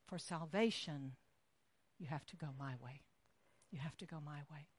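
gentle, kind. Acted. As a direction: speak it kindly, as Jesus. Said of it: "very kindly and gently".

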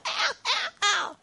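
very high squeaky ows; high-pitched mock ouch cries; child's mock ow. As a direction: yelp like a kid under the needle. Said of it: "Every once in a while".

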